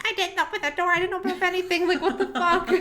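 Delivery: high, loud, defensive British protest; British accent. Retold or imitated. Neil denying he opened the door, frantic British accent. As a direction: imitate a panicked man protesting in a British accent. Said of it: "In his British accent".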